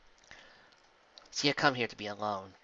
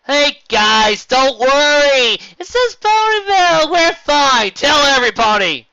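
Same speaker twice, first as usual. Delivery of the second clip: calling out=51; 16 dB louder, slower than usual. Acted. Flying over crowd, calling out.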